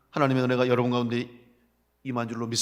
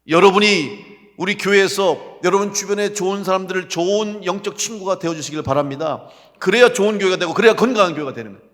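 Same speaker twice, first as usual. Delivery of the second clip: loud, raised exhortation; forceful preaching; 5 semitones higher, 11 dB louder than usual. Real, not acted. Preacher urging church members to be good friends, forceful preaching. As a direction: urge the congregation on loudly.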